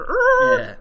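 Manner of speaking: high, loud comic whirr; imitated slider noise. Co-host showing how the slider went, imitated noise. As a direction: mimic the slow machine trundling along. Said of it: "you just saw them go".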